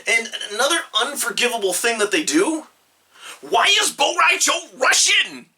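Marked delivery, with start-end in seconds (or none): 3.4-5.5 exasperated outrage. Real, not acted. Outraged rant about a miscast accent.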